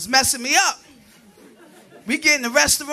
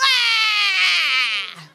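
disgusted gag cry; 5 semitones higher than usual. Retold or imitated. Spit in mouth at nurse.

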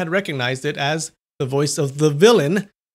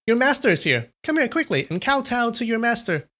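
higher, taunting call; teasing call; 10 semitones higher than usual. A narrator's reading. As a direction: taunt him through the wall. Calling through wall, teasing.

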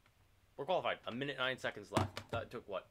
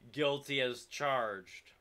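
drawn-out mock confession; 5 semitones higher, 6 dB louder, much slower than usual. Real, not acted.

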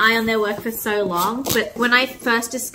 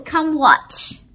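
ordinary talk

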